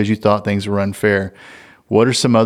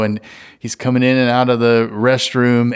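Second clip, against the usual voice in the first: usual speaking voice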